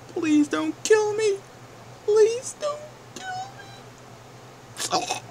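high, slow, wailing plea; beg, stretching every word; desperate begging. Acted. Begging for his life.

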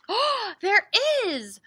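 high, loud, drawn-out discovery; delighted surprise. Acted. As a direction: gasp and marvel for the children. Discovering another tree in story.

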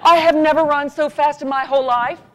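high, loud excited telling. Real, not acted. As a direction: relive the rush with a cry. Excited retelling of the run.

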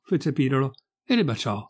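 ordinary delivery, nothing marked

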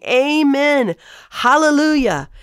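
high, drawn-out worshipful exclamation; exclaimed praise. Acted. Elders worshipping God on the throne, exclaiming praise. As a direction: proclaim each word as a lifted cry of praise.

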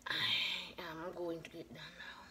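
very soft, low and whispered; barely voiced aside; hushed whisper. Real, not acted. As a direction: say it under your breath.

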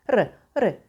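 "R" as one tiny tongue flick; brief, barely trilled "r"; single-tap R. Shown as a demonstration. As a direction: give the R just one light beat. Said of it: "bir vuruşçuk".

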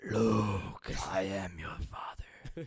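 a lightly voiced, slow villain line; a hint of Darth Vader's slow menace. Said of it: "That's probably really bad".

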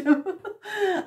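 real high laugh; high delighted laugh. Real, not acted. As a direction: burst of bright laughter. Delighted by the card.

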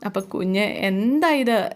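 put-on slow, different voice for the older man; Ayyappan's question. Ayyappan asking about the baby, slow bewildered query. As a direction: ask slowly as a bewildered elder. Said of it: "എന്ന് അയ്യപ്പൻ ഇങ്ങനെ ചോദിക്കുക".

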